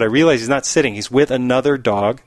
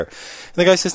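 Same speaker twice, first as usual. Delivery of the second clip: ordinary talk, nothing marked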